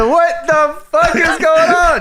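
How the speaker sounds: high-pitched mock outraged yell; drawn-out, high retort in mock outrage. Wrestler answering an insult, mock outraged. Acting out Moolah's outraged reply.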